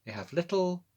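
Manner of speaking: usual speaking voice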